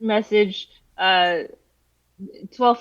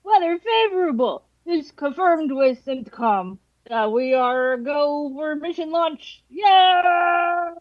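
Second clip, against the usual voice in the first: frat bro voice; 7 semitones higher, slower than usual. Pretend. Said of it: "my impression of a frat bro".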